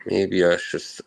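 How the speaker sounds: ordinary delivery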